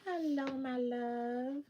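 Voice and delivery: slow soft loving hello; tender. Real, not acted. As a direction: greet someone dear gently.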